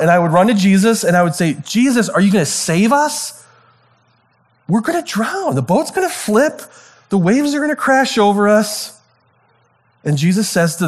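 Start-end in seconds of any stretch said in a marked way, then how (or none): 1.6-9.0 high, panicky pleading; freaking out. Retold or imitated. Preacher imagining himself on the boat, running to Jesus in panic. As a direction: beg Jesus for rescue in a rising panic. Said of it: "But I'd be freaking out".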